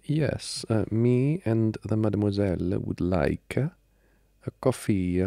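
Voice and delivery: high, slow mock-French accent; fake French accent. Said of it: "stop faking the accent".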